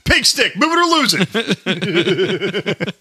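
high, drawn-out jokey holler; comic heckling voice. Acted. Clowning at slow drivers, silly high holler. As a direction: put on a silly road heckle.

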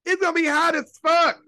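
near-crying outrage. Retold or imitated. Repeats it, louder and higher.

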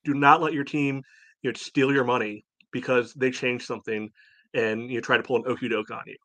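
ordinary talk

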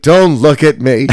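loud, high pleading voice; mock desperate plea; ashamed pervert kid. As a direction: beg not to be seen, hammed-up. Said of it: "He's like".

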